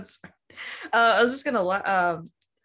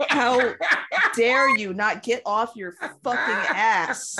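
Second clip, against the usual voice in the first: mock-outraged dogs; 2 semitones higher than usual. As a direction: play an affronted dog, leaning on each word. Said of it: "good delivery".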